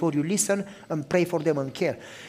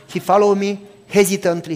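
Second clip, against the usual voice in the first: usual speaking voice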